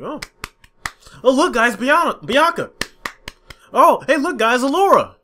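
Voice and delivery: sarcastic gee-whiz at each reveal; mocking fans cooing over character reveals, high and fake-thrilled; mock excitement. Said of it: "not being excited over and over".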